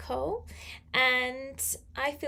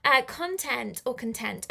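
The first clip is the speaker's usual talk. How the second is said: ordinary delivery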